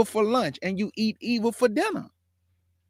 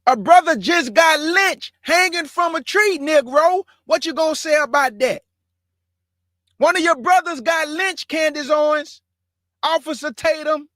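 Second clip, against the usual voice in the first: higher and louder, heated and indignant; preacher raising his voice at public figures in anger; outraged call-out; 6 semitones higher, 5 dB louder than usual. Real, not acted. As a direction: call them out by name, voice raised. Outraged call-out over a lynching.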